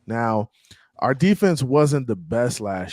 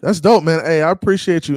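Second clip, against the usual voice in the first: louder, higher, full of energy; excited thanks; 6 semitones higher, 7 dB louder than usual. Real, not acted. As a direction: hype up the guest with thanks. Enthusiastic thanks to guest.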